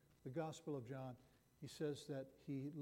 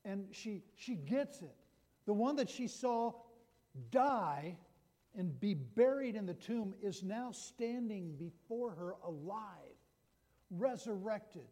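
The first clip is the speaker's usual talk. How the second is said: louder, rising sermon build; impassioned preaching; 4 semitones higher, 7 dB louder, slower than usual. Acted. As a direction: preach it with mounting fervour. Preaching the resurrection climax.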